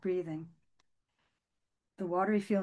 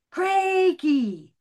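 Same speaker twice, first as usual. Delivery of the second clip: high, loud call across the pond; 10 semitones higher, 9 dB louder than usual. A narrator's reading. Miriam calling Crakey in the mill pond. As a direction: call her name far over the water.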